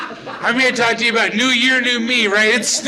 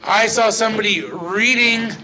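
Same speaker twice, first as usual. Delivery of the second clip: ordinary talk, nothing marked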